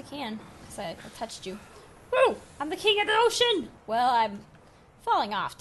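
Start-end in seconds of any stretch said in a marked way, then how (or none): 2.1-3.7 higher and louder, gloating in triumph; triumphant shout. Real, not acted. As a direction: crow like a victor. Triumphant cry.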